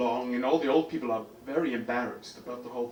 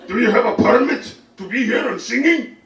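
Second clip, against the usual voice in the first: loud, raised-pitch angry interrogation; hammed-up indignant shout; old man screaming; 8 semitones higher, 10 dB louder than usual. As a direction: yell it like an irate old man. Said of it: "when people scream at me like that".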